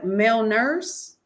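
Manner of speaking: slow, questioning echo; retold puzzlement. Doctor querying the patient's story, puzzled. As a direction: repeat it slowly, rising in doubt.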